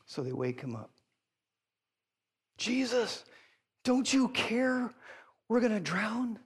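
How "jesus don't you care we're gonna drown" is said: high, drawn-out mock panic; panicked disciples. Disciples waking Jesus in a storm, mock panicked. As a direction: plead in a raised voice, lightly acting alarm. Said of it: "And they're panicked".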